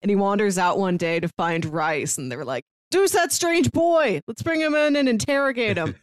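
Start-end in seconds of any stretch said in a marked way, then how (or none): 2.9-6.0 lightly voiced soldiers. Retold military characters voiced.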